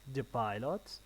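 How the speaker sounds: "The Pilots" said as a question, pitch climbing; rising intonation. Shown as a demonstration. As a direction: end "Pilots" on a rise. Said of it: "rising intonation".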